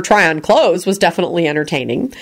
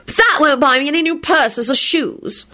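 put-on smug, high-pitched girl's voice; 10 semitones higher than usual. A narrator's reading. Spoiled daughter angling for purses and shoes, smug. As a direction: add it smugly, pitched high. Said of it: "she added smugly".

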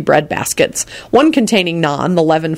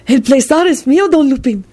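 much higher, fawning Spanish servant; gushing; 10 semitones higher than usual. A narrator's reading. Servant thanks Don Lupin, gushing and servile. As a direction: gush eagerly in a high, obsequious voice. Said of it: "he gushed".

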